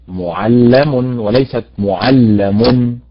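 "معلم وليست معلم" slow, the stress moved between takes; right then wrong stress. Shown as a demonstration. Teacher contrasts correct and wrong معلم. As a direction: contrast the two "معلم" by where the weight falls. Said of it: "فاعل وليست فاعل".

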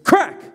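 high, punchy sound-effect crack; sharp crack cry. A narrator's reading. Narrator voices belt striking hands, high sharp crack. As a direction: snap the word out like a whip.

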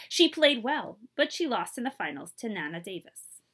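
plain reading voice

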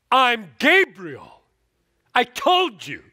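loud, high, slow indignant rebuke; indignant angel. Acted. Preacher voicing Gabriel rebuking Zechariah, loud and indignant. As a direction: boom it out like an offended angel. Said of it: "that's my tone of voice".

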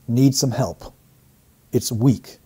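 ordinary talk

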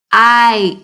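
K, then a long stretched ite; segmented kite. Shown as a demonstration. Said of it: "Do you hear the long I sound".

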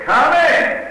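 loud, high call through the door; shouted come in. Retold or imitated. Called out loud to a knock at the door. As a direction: shout for the visitor to enter. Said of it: "And I shouted".

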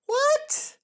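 very high, loud yelp; startled shriek. Real, not acted. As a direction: shriek in shocked disbelief. Startled by the video.

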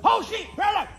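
high, loud startled cry; startled yelp. Acted. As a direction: jump at the noise and yelp. Startled by a squirrel.

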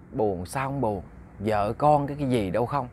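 low, softly spoken dejection; sad. Acted. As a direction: sigh it out quietly, downcast. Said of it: "cái ông buồn". (giọng buồn, trầm và nhỏ)

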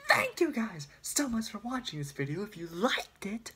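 high loud Irish YouTuber shout; imitating Jacksepticeye's outro; Jacksepticeye impression. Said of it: "How does he do that?".

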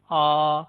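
"आ" said open, like the a in ball; Turkish A. Shown as a demonstration. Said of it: "बॉल के अंदर हम ए को pronounce करते हैं वैसे". ("आ" बॉल वाली खुली आवाज़ में)

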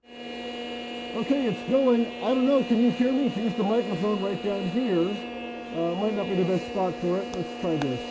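muffled behind respirator; through a face mask. Real, not acted. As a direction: voice inside gas mask. Seen 1: Full-face respirator worn over whole face throughout; absent at -2.0.

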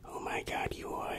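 usual speaking voice

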